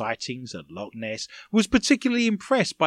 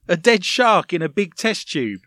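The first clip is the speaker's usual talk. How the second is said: ordinary talk, nothing marked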